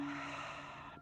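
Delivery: long, soft exhaled sigh; sigh of relief. Shown as a demonstration. Yoga teacher showing students the exhale, sigh of relief. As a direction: let the breath go in an unvoiced, relieved sigh. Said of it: "just a sigh of relief".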